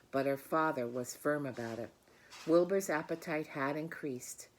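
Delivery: put-on warm, expressive read-aloud to children; gentle storybook reading voice; story narration. A narrator's reading. Reads the story aloud to class.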